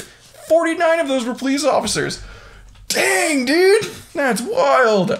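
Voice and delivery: high-pitched, amazed outburst; astonished. Real, not acted. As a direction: burst out in disbelief, voice leaping up. Astonished at injured police count.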